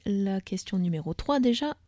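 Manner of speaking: normal talking voice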